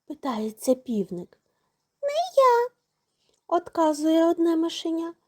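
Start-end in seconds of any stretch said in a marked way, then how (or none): 2.0-2.7 very high little squeak; mouse voice. A narrator's reading. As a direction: squeak a refusal like a tiny mouse. Mouse refuses rooster in tale.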